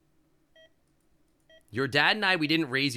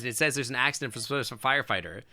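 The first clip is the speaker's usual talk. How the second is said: ordinary talk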